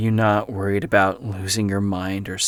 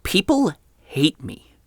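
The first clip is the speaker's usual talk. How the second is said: pitched much higher in upset disbelief; agitated protest; 6 semitones higher, 4 dB louder than usual. Acted. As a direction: push back, voice climbing higher. Podcaster protests the offer.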